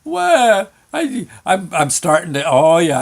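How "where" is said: put-on high, loud childish wail; mock crying. Kid alone on sled in fog, mock crying. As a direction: bawl like a frightened kid, hammed up. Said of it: "I started to cry".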